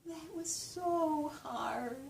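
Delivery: very slow, high, dreamy glide; alternative melody. Shown as a demonstration. As if something hard was desirable, slow and high. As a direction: draw it out high, savouring "so hard". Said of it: "could that set of words be spoken differently?".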